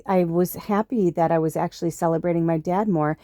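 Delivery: usual speaking voice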